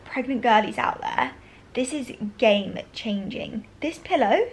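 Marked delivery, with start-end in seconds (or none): none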